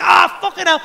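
high, loud shocked curse; pained outburst. Retold or imitated. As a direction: curse as the smoke hits. Reacting to harsh smoke.

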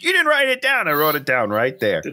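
high-pitched incredulous jump, then settling; scandalized that it was left out; mock outrage. Real, not acted. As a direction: exclaim in disbelief, starting high. Mock outrage at co-host's missing note.

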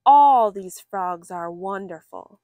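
plain reading voice

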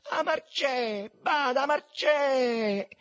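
very high, long-drawn yell; loud, slow holler from afar; shrieked warning. A narrator's reading. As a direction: stretch the name into a high warning cry. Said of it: "fermato da uno strillo".

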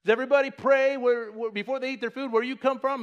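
high, loud mock call from cheeky youngsters; heckling youngsters. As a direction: call out teasingly to an old farmer. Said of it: "and they're like".

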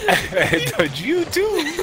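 laughing. Real, not acted. Laughing during play.

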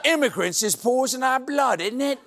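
high, laughing bad Cockney accent. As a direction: put on a hammy Cockney accent. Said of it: "bad Cockney accent".

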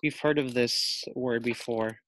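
usual speaking voice

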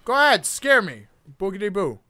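loud cocky dare, then mock spooky; taunting. Real, not acted. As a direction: brave front against fear. Taunting the game.

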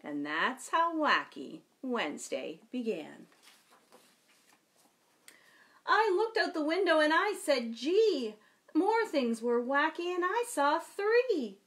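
put-on lively, slow read-aloud for children; storytime reading. A narrator's reading. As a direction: read it like a picture book to kids. Reads picture book aloud.

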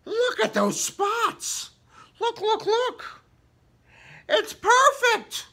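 high, slow, excited put-on voice; squealing delighted human character. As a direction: gush over the perfect find. Human voiced finding giraffe.

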